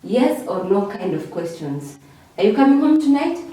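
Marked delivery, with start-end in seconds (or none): 2.4-3.4 quick, higher, louder yes-or-no question rising to "tonight". Shown as a demonstration. Teacher models a yes-or-no question for class, rising intonation. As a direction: lift the pitch through to "tonight" as a question. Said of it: "So those fall under rising".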